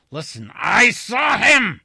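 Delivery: high, loud desperate shout; voice breaking. A narrator's reading. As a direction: shout until the voice cracks. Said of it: "sudden break in his voice".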